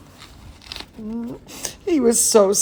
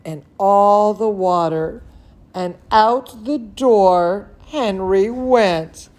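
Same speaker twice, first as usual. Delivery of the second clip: usual speaking voice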